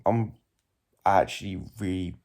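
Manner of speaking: low, slow, worn-out voice; tired. Real, not acted. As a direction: drag the words out exhausted. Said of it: "freaking tired right now".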